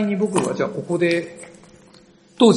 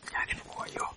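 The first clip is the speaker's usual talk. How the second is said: whisper; 16 dB quieter, faster than usual. Real, not acted. Whispering in a dark tunnel.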